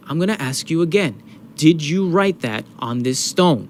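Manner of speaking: slow, deliberate, pointed demand; retold interrogation. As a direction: imitate an interrogator pressing for an answer. Acting principal repeating his interrogation.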